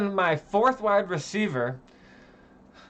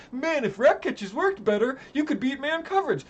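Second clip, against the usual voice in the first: mocking quote; 8 semitones higher than usual. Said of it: "people were like".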